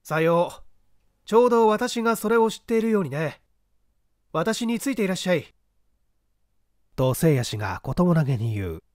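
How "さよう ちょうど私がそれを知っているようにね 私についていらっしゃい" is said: calm, assured voice of the detective, a touch higher than the narration; a lightly voiced, unhurried gentleman sleuth; composed gentleman detective. The detective calmly directing the man up the ladder.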